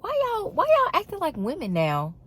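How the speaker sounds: high, disbelieving question; incredulous. Retold or imitated. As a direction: raise the pitch in mock disbelief. Retold reaction to soft guy.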